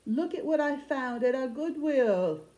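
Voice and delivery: high, drawn-out mock English accent; bad English accent. Vlogger showing off a Goodwill find, bad English accent. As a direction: play up a badly faked English voice. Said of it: "I go into my very bad English voice".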